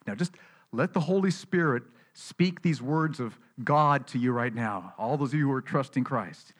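ordinary talk

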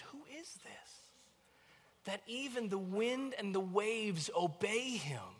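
high, hushed, slow amazement; awed wonder. Acted. Disciples asking after Jesus calms the storm, amazed. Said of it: "out of wonder".

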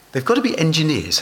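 ordinary talk, nothing marked

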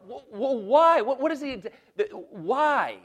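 loud, high impassioned preaching; preaching at full force. Acted. Pastor pleading Pilate's case to the congregation, loud and impassioned. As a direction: thunder it from the pulpit.

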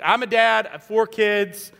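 normal talking voice

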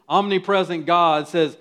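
usual speaking voice

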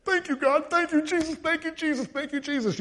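high, breathless mock praise chant; gushing thanks. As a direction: parody an overeager believer repeating thanks. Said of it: "I thought he would have said".

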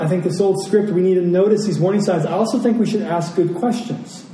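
usual speaking voice

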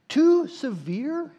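mock his disbelief with a sharp rise; angry disbelief. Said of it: "it was evident he was angry".

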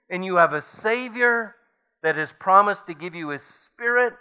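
ordinary delivery

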